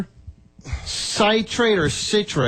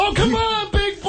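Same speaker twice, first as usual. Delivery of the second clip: mock egging on. Real, not acted. Teasing friend into laxative challenge.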